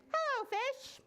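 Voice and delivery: put-on high, bright-pitched boy's hello; boy's greeting. Boy in story greeting a fish, high-pitched hello. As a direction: call out a friendly high greeting.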